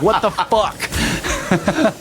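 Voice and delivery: drawn-out high laughing cry; giggling through the disbelief. Retold or imitated. Brother ending the dream story in a slow laughing cry. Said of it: "and I'm just like".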